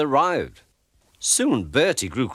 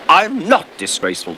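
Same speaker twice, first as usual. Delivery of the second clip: choking; 6 semitones higher, 7 dB louder than usual. A narrator's reading. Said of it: "choked Thomas".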